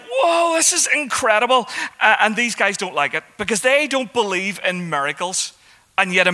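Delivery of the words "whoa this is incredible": amazed exclamation. Retold or imitated. Crowd amazed by healed man.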